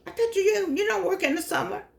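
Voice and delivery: high, mock-incredulous friend's question; surprised friend. Quoting a friend who doubts she works: high surprised question.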